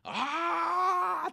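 long high scream trailing off as he passes; cyclist's scream. Retold or imitated. Cyclist yelling past in the cold night, a high drawn-out scream. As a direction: imitate the cold cyclist's yell fading away. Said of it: "って言いながら".

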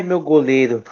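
ordinary delivery

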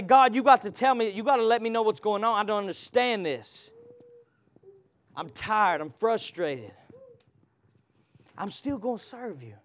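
softly voiced weary prayer; quiet honest prayer. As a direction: pray it tired and low. Voicing honest prayer to God.

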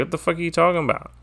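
quick, high-pitched mock crowd reaction; baffled crowd. Host mimics the crowd answering Becky, baffled, quick and high. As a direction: imitate a puzzled crowd, high and hurried. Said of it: "And the crowd's like".

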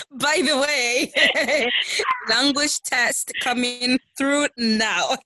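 slow, drawn-out joke through laughter; laughing mock announcement. Listener joking about a surprise language test, laughing. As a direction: announce it teasingly, cracking up.

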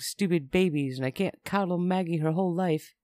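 ordinary delivery

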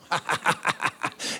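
low staccato "ha-ha-ha"; mock sinister chuckle. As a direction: chuckle like a gleeful villain. Mocking a God eager to kill.

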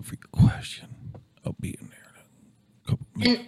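high whisper. Acted. Unvoiced high put-on voice.

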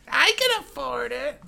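high-pitched mimic of the movie line; high squeaky quote. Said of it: "someone made that joke from the movie".

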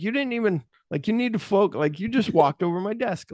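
high-pitched imitation of indignant disbelief; mock indignation. Kid whose desk just got walked over, mock indignant. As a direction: pitch it way up, comically affronted.

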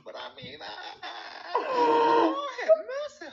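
put-on comic high-pitched American accent. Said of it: "y'all heard them doing this funny accent".

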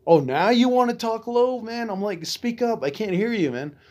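high, sarcastic jab to speak up; mocking retort. To the mumbling secretary, a high mocking retort.

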